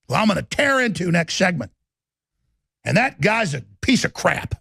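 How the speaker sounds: loud, relentless tirade at enemies; furious shouted rant. Real, not acted. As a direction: hammer every line at full volume. Holds a full-force rant.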